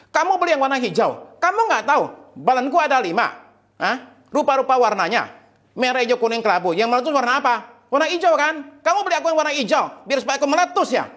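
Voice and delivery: high-pitched hammed-up tirade; exploding husband. Husband ranting about five balloons and green, exploding. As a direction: blow up comically, pitch held high throughout. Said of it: "itu suami meletus dan meledak".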